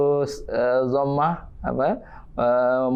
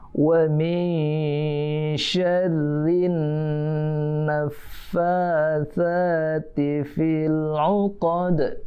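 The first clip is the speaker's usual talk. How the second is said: slow drawn-out Quran chant, higher; Quran recitation; 4 semitones higher, much slower than usual. Shown as a demonstration. Teacher chanting a verse for children to repeat, slow and drawn out. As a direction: chant each word slowly for the class to copy.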